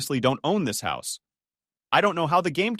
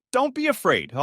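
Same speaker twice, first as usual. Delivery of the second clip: shout; 10 semitones higher than usual. A narrator's reading. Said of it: "he shouted".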